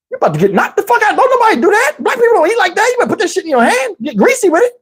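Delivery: high-pitched mock tirade; acted scolding. Flashback of a prison scolding.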